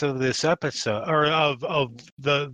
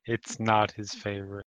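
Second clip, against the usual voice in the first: low, hushed documentary narrator; quiet, solemn narrator aside; 6 semitones lower, 6 dB quieter than usual. Pretend. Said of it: "Narrator voice".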